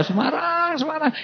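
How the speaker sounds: very high, stretched comic wail; mock agonized answer. Injured victim answering a pointless question, high mock-agonized wail. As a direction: cry out the hometown like a victim in pain.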